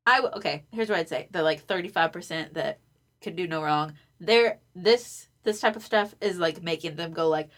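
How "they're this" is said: ordinary talk, nothing marked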